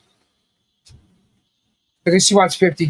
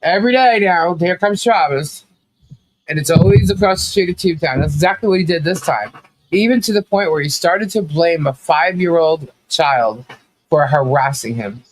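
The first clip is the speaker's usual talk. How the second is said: ordinary talk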